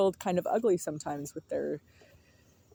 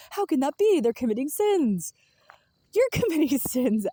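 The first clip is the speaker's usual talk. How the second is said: a loud, higher mock of the pious outrage, laughing through it; mock outraged believer; 8 semitones higher, 5 dB louder than usual. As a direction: ham up the scandalized churchgoer. Mocking judgmental churchgoers' outrage.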